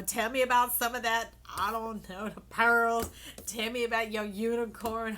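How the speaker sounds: loud eager call-out; loud excited plea. Real, not acted. Reader begging viewers for comments, loud eager.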